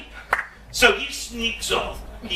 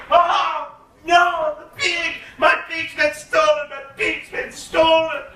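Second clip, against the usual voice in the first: higher, louder hammed-up cry of loss; mock wailing; 9 semitones higher, 4 dB louder, slower than usual. A narrator's reading. Calandrino discovering his pig is gone, mock wailing. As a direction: wail over the lost pig, half in fun. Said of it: "he starts screaming and crying".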